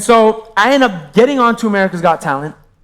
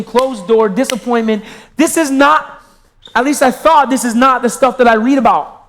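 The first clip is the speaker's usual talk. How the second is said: ordinary talk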